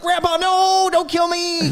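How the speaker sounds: high, loud frightened cry; mock terrified yell at grandpa; terrified plea. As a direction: beg for your life like a scared kid. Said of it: "'cause I was terrified".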